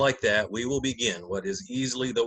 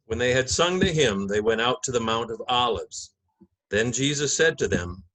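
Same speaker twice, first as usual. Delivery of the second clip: measured, solemn Gospel narration; liturgical reading; 4 dB louder than usual. Acted. Narrator opening the Passion for the congregation, solemn reading. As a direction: read it like Scripture from the lectern.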